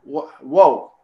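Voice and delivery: sudden loud startled whoa. Retold or imitated. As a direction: jolt back in surprise. Retelling shock at needle sensation.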